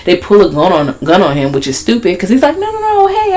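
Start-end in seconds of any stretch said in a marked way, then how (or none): 2.6-3.3 frantic plea. Retold or imitated. Man pleading with armed cops.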